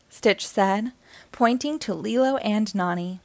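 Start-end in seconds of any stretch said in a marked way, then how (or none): none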